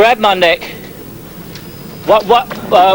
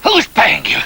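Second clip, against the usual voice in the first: raised, scornful questioning; interrogating officer; 13 semitones higher than usual. Acted. Officer accusing the mercenary of working for the French, scornful. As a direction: sneer the accusation at him.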